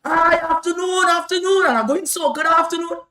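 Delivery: high, loud called greeting; shout. Retold or imitated. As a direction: shout it so the family finally hears. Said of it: "I shout".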